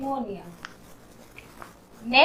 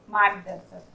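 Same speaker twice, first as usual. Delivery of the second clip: ordinary talk, nothing marked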